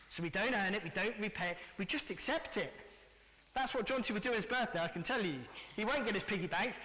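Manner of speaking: ordinary delivery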